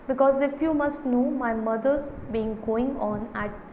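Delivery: ordinary delivery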